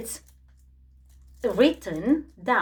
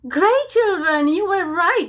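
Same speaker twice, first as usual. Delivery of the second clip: excited praise; 6 semitones higher, 4 dB louder than usual. Acted. Praising children after guess.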